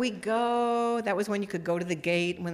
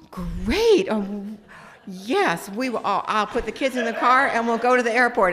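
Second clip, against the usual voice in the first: forced cheer; 7 dB louder than usual. Retold or imitated. Wife fakes cheer on phone.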